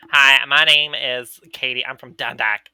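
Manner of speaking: put-on loud, drawn-out Dundalk accent; annoying Dundalk accent. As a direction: imitate a coworker's grating Dundalk twang. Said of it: "the way she fucking talked was so annoying".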